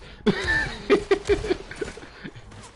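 squealing laughter; high-pitched laugh. Real, not acted. Laughing at the joke.

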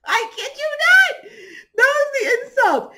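high, loud, laughing disbelief; can't believe it, laughing out loud. Real, not acted.

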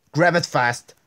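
loud, urgent shout at the screen; frantic yell. Real, not acted. Gamer yelling at the screen, frantic shout. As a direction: yell it, desperate for speed. Frustrated player yelling at game.

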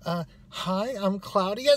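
high, hesitant nervous introduction. Retold or imitated. Library worker introduces herself to new hires, nervously. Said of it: "She's really nervous. She's like".

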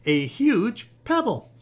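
loud, high and drawn out; triumphant shout. A narrator's reading. Jessica shows off her stone to the frogs, shouting triumphantly. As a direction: announce the great find with triumph. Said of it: "she shouted triumphantly".

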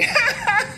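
quick high laugh edged with tears; a brittle servile little laugh; high mock laugh. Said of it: "I say".

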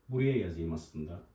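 ordinary talk

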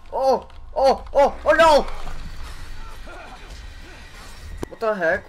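high, loud frantic cries; panicked shouting. Real, not acted. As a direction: scream to escape. Fleeing the monster.

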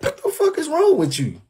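a high-pitched incredulous outburst at her; retold outburst. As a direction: shriek it up high in disbelief. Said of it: "I'm like".

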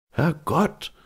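high, startled exclamation without extra volume; startled cry. A narrator's reading. Marvel flinches at the sailor's word, startled. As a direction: jump at it, pitch leaping up. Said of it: "rief Mister Marvel zusammenfahrend".

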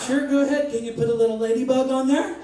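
put-on high, girlish-sweet sudden delight; mock delighted gushing. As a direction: melt into delight, hammed up. Acted sudden delight at pretty nails.

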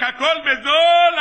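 imitated vendor calling out; street vendor's shout. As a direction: hawk your wares across the market. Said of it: "ההוא עוד צועק".

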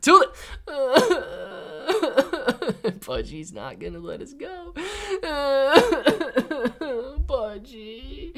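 high, half-laughing fake sob; mock crying. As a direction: pretend to cry as the stream ends. Mock crying at stream end.

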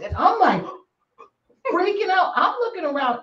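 ordinary delivery

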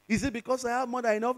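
usual speaking voice

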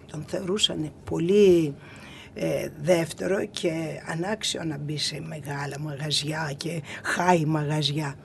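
normal talking voice

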